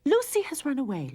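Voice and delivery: high, loud angry complaint. A narrator's reading. Mother cat furious at her runaway daughter, angry. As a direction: let the anger out. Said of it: "Lorene said angrily".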